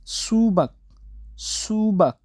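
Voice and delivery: careful demo of सूबक; Korean word for watermelon. Shown as a demonstration. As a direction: model सूबक for the learner. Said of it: "इस तरह बोलते हैं".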